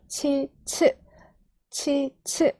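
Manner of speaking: "치, 츠" as separate, carefully modelled syllables; Japanese chi-tsu demo. Shown as a demonstration. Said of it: "이런 식으로 발음을 하면".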